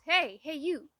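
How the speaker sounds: mock call across the path; loudly hailing her from a distance. Stranger at work calls out to her, a mock loud hail. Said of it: "some guy goes".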